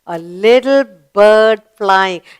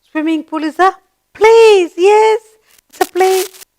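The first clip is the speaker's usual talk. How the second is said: excited praise; 10 semitones higher, 3 dB louder than usual. Real, not acted. Teacher praising pupil's answer.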